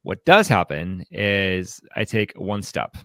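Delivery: ordinary talk, nothing marked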